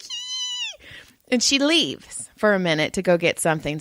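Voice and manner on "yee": very high, soft squeak of joy; a silent inner cheer leaking out as a squeak; giddy little yee while lying on the table; tiny squeal of glee. Retold or imitated. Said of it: "in my head, I'm clapping".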